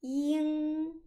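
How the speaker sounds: yin held high and level; first tone yin. Shown as a demonstration. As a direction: yin on one flat high pitch. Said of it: "Attention la prononciation".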